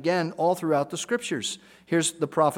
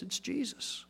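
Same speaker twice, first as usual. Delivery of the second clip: very soft, near-breathed answer; hushed reveal; 8 semitones higher, 14 dB quieter than usual. Real, not acted. Preacher revealing the answer to his congregation, hushed. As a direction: let the name land in a hush.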